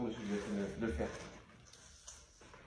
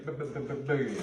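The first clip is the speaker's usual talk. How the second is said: stuttering bu- bu- like a glitch, then a louder bugué; mock glitch stutter; 4 dB louder than usual. Vlogger mocking her own slip, fake glitch stutter. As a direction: stammer like a frozen video. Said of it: "il a bugué".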